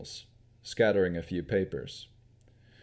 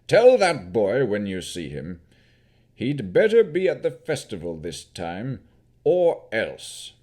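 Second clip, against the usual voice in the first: loud, menacing lord's warning; a baron's loud warning to the visitors about his son; stern Baron; 7 dB louder than usual. Acted. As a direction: threaten the absent son through them.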